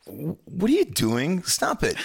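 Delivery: pretending to panic at his wife; exasperated protest. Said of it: "I'm just like".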